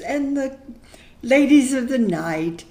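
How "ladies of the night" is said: "ladies of the night" spoken openly and louder, stress on "ladies"; now say "ladies of the night" boldly, no hush. Real, not acted. Repeats the phrase, now aloud.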